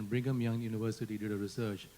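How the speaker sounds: ordinary delivery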